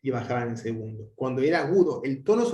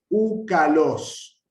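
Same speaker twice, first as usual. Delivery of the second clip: úcalos stretched slow and loud as one word, weight on los; proclitic demonstration; 2 semitones higher, 6 dB louder, much slower than usual. Shown as a demonstration. Teacher shows students the proclitic, úcalos slowly joined. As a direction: draw out úcalos, landing on los. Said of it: "de la palabra que les sigue".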